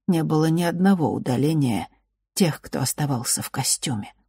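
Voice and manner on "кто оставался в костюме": ordinary delivery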